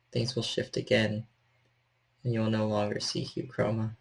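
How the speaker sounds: normal talking voice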